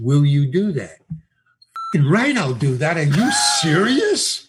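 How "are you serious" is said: mock disbelief. Mock disbelief at big offer.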